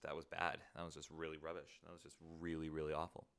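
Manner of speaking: ordinary talk, nothing marked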